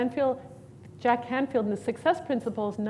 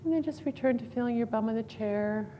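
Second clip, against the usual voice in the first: calm, unhurried meditation guidance, trailing softer; guided meditation; 7 dB quieter than usual. Acted. Instructor guiding the class through a breathing exercise, calm meditation voice. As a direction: ease the group into noticing their body and breath.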